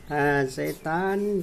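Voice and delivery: slow, stretched teasing; very slow, lingering over Shaitaan; drawn out. Real, not acted.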